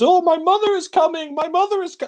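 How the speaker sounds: high, loud mock cry; shrill-pitched mocking squawk of a movie line; mimicking the film character. Reviewer mocking a terrible film scene, high squawked impression. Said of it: "and he says".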